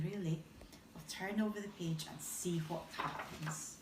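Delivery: plain reading voice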